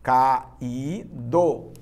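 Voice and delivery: "Caído" split into ca, í, do; syllable by syllable. Shown as a demonstration. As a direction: say "Caído" in three separate, drawn-out syllables. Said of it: "separa as sílabas comigo".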